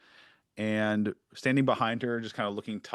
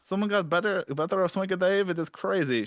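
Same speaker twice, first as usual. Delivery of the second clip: higher, boastful storyteller; showy Dave voice; 8 semitones higher than usual. Acted. Dave boasting to Charlie, higher and showy. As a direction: brag about the kidnapping. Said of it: "He's like".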